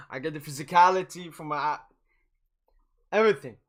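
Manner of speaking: ordinary talk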